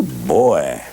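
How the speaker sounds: usual speaking voice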